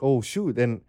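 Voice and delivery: usual speaking voice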